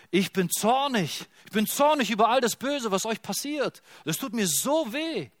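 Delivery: God grieves and burns at once; voicing God. God speaks to his people, impassioned angry grief. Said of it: "und er sagt".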